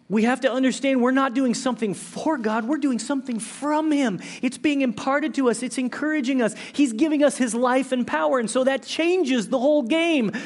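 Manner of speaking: loud, high preaching climax; preaching at full force. Acted. Pastor preaching loudly to his church. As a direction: drive it home to the congregation.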